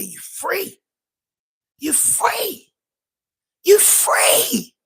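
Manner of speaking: a guard calling out the release, building up; mock guard announcing release. Guard at the cell door telling the prisoner he's free, building.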